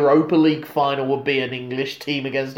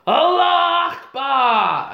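shouted quote; 8 semitones higher, much slower than usual. Retold or imitated. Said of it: "So Faisal goes".

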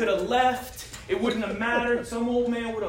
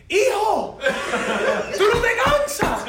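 high, slow mock old Cuban man; lightly teasing, high old man voice; 11 semitones higher, 5 dB louder, much slower than usual. Old Cuban guy teasing the worker from the street, high and slow. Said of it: "he's telling me".

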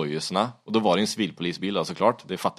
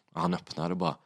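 normal talking voice

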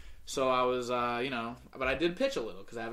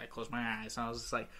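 usual speaking voice

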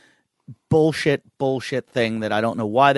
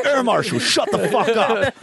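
laughing mock order; 10 semitones higher than usual. Man answering a nagging passenger, laughing. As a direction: bark it while cracking up.

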